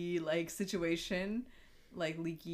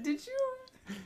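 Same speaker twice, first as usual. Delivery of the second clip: high mock question. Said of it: "she said".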